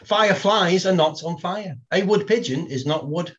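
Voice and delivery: ordinary talk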